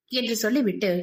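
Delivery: ordinary delivery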